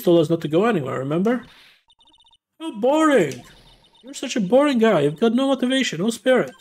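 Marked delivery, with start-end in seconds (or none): 2.6-6.5 put-on high, pouty girl voice scolding him. Little girl scolding Nick for being boring, in a high pout. Voicing a character in the game.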